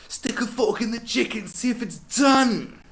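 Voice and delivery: high, loud, slow hype-man bellow; over-the-top DJ shoutout; hyped DJ shout. Retold or imitated. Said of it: "Actual lines of his".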